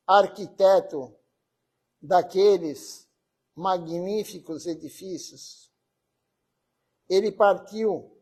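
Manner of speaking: slow, deliberate reading with long pauses between phrases; formal devotional tribute reading; solemn reading aloud. Acted. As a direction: read it out measured, phrase by phrase. Reads a tribute text aloud.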